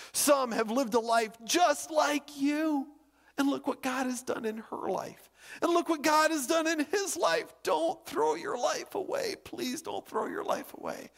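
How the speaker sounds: high, strained plea, fading at the end; imitated tearful pleading. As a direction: beg through imitated sobs, voice climbing then weakening. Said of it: "And Marla sobbing".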